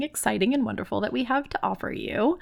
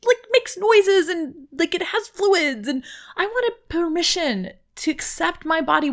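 higher and louder, tumbling confession; worked-up rant; 7 semitones higher, 4 dB louder than usual. Real, not acted. Writer owning up to her weird body, worked-up rant. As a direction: build into a high, exasperated run-on.

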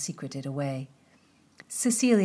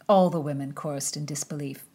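usual speaking voice

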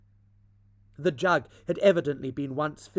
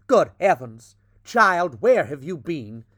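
sharp, loud angry father; father scolding his lost daughter, loud and angry; rather angry; 8 dB louder than usual. A narrator's reading. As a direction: scold her out of relief and anger. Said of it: "said Mister Otis rather angrily".

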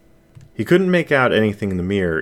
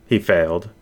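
ordinary delivery

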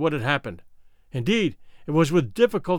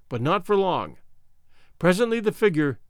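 plain reading voice

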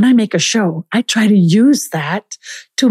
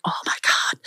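whispered exclamation, as if awestruck; whispered awe; 9 dB quieter than usual. Acted. Reacting to hearing of the philosophers, awed whisper. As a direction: whisper it in mock amazement.

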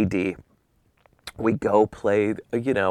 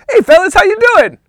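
big, high, hearty shout; booming happy greeting; 12 dB louder, faster than usual. Retold or imitated. O J walking up to the golfers, booming and happy. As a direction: boom it out like a glad-handing star. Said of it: "boom, and happy".

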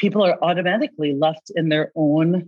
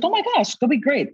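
much higher, bright burst of praise; excited cheer; 9 semitones higher than usual. Retold or imitated. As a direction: cheer the client on like a coach. Coach cheering client's plan.

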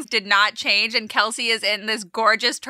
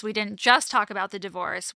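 usual speaking voice

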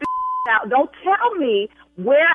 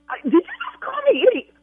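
outraged disbelief; 10 semitones higher than usual. Real, not acted. Insulted caller snaps back in disbelief.

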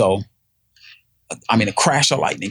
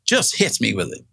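ordinary delivery

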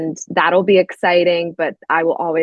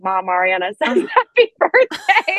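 high-pitched, laughing fangirl squeal; retold giddy excitement; 9 semitones higher than usual. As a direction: relay big news to mom, breaking into laughter. Retold giddy message to her mom.

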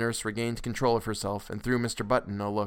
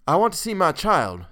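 higher, louder anxious demand; insistent; 7 semitones higher, 7 dB louder than usual. A narrator's reading. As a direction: blurt out the demand. Anxious father demands his child.

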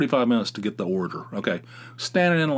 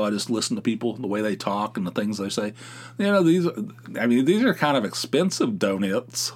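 normal talking voice

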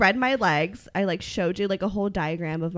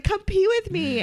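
high playful call; 11 semitones higher, slower than usual. Retold or imitated. Own invitation voiced in story.

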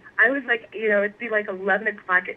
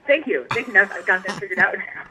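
sarcastic thanks; 4 semitones higher, 5 dB louder than usual. Retold or imitated. Sarcastic thanks after magnet stuck.

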